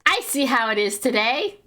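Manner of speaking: slow, pointed sarcastic aside; drawn-out knowing sarcasm; sarcastic remark. Retold or imitated. Reacting to the cashier ignoring a woman, slow sarcasm. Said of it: "And I'm like".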